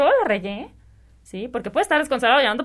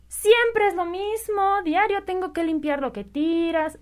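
higher, slow, exasperated reproach; scolding mom; 7 semitones higher, slower than usual. Retold or imitated. Mother reproaching her child over the mess, exasperated. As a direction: nag like a fed-up mother. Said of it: "Es muy diferente eso a decir".

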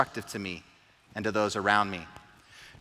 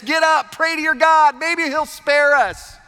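urgent, high-pitched shout to rouse a sleeper; loud, alarmed yell, much higher than usual; captain yelling; 11 semitones higher, 4 dB louder than usual. Acted. Captain yelling at sleeping Jonah in the storm, urgent shout. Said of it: "The captain is yelling at him".

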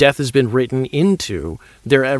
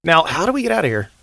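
acted excitement. Acted escape from Phantom Zone.

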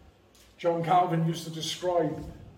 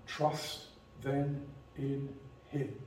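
gentle exhortation; 3 semitones lower, 10 dB quieter, slower than usual. Real, not acted. Closing exhortation to trust God.

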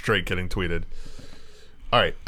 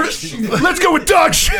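high, drawn-out shout; rallying cry; 7 dB louder, slower than usual. Acted. Game master cheering the players' choice of dog shit, mock rallying shout. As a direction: whoop it out like a battle cry.

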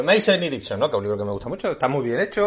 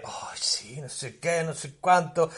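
mock pretentious whisper; 8 dB quieter, slower than usual. Mocking pretentious arthouse film.

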